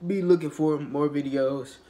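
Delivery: ordinary talk, nothing marked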